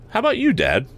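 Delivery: put-on high-pitched boyish question to his father; eager youth. A narrator's reading. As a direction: pitch it well up like a youth asking Dad. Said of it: "agreed the youth".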